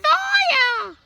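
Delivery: very high, slow, loud screaming granny; Grandma yelling. A narrator's reading. Grandma yelling from the roof that the hen burns. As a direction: shriek the alarm like a panicked old woman. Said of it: "Grandma yelled".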